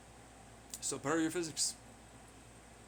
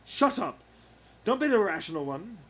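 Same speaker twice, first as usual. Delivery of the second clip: angry; 8 dB louder than usual. Acted. Snapping at other voice.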